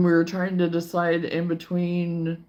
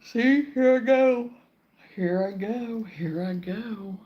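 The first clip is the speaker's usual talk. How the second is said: yawning through the words; 4 semitones higher, slower than usual. Real, not acted. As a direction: say it mid-yawn, drawn out. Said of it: "I'm gonna be fading out".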